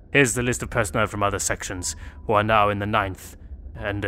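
low, quiet, grave note reading; somber reading. Acted. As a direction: read it like a last letter. Reads the dying note.

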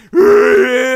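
high, loud mock screech; crazed creature growl. Imitating a Pokemon stuck in its ball, crazed screech. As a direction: snarl like a caged Pokemon. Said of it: "how those Pokemon feel like".

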